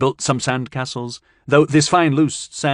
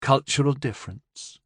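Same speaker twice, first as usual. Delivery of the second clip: lofty; 3 semitones higher, 3 dB quieter than usual. Acted. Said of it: "Jay said loftily".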